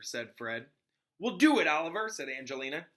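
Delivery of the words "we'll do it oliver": eager. Acted. Angelina cheers the captain on.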